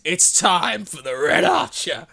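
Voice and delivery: slow, drawn-out hero line; heroic catchphrase. Acted. Trying out a catchphrase to start a heist, mock-heroic. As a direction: stretch it like a cheesy battle cry. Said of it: "That needs to be my catchphrase".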